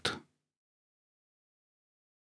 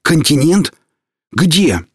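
sudden hoarse, high question; hoarse disbelief; 7 semitones higher, 4 dB louder, slower than usual. Acted. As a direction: rasp it out in shock. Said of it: "переспросил он враз охрипшим голосом".

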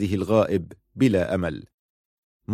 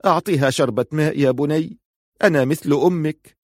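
higher-pitched, mildly imploring tone; pleading; 6 semitones higher than usual. A narrator's reading. Mother begging the soldier for water, mildly pleading. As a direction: beg gently, voice lifted. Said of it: "وقالت في رجاء".

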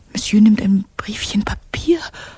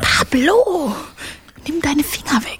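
higher, louder cry of protest; indignant outburst; 5 semitones higher, 4 dB louder than usual. Acted. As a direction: snap at him to get his hands off. Maid fending off groping boy.

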